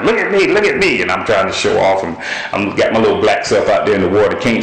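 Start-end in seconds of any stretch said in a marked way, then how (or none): 0.0-1.0 high, eager "watch me" shout; showing-off call. Retold or imitated. Kid at the beach showing off to a friend, showing-off call.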